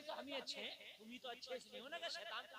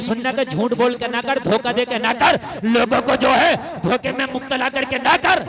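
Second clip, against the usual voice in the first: loud, ringing sermon delivery; fiery preacher's call; preaching at full force; 27 dB louder than usual. Acted. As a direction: thunder it to the gathering.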